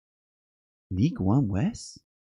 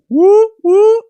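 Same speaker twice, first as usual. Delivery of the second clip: very high, loud siren wail; mimicking the police siren song; 14 dB louder than usual. Shown as a demonstration. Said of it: "it's the sound of the beast".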